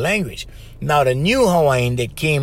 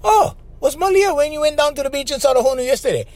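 much higher, fast, hammed-up parroting question; mock squeaky echo; 11 semitones higher than usual. Radio partner parroting the professor, squeaky mock question. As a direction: squeak the whole line back as a silly question. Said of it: "back and forth".

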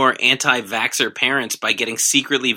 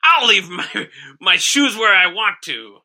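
pirate voice; 8 semitones higher, 6 dB louder than usual. Pretend. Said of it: "pirate voice".

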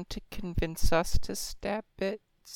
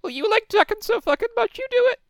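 high, pitched-up mocking jab; character voice; 4 dB louder, faster than usual. Player's character sneers at the new guy in a high voice. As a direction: squeak it out as a snide taunt. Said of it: "I'm gonna say".

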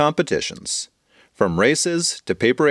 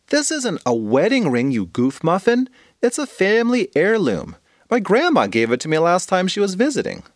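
put-on higher teasing kid voice; higher girl laughing off the mix-up; amused friend; 7 semitones higher than usual. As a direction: set her straight fondly.

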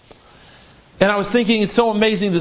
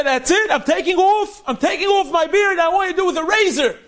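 high, raised cry, faster at the end; a young man fed up, lashing out; angry outburst; 7 semitones higher than usual. Retold or imitated. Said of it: "He was angry at Hashem".

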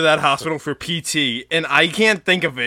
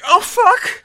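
high-pitched yelp of alarm; mock panicked exclamation; pretend startled cry; 4 dB louder than usual. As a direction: cry out as if suddenly teleported. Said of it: "You just say".

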